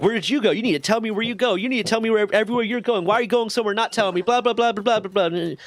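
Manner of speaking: imitated high-pitched nagging tirade; mock drilling scolding. Sergeant drilling into her airman for walking off, mock scolding. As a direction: rattle off a mocking telling-off that dissolves into blah-blah. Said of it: "Sergeant starts drilling into me".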